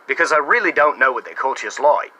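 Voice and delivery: hammed-up Aussie accent, mate; mock Australian accent. Shown as a demonstration. Bar prankster fooling people for free drinks, in a put-on Australian accent. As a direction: put on an outback Australian twang. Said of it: "I do an Australian accent".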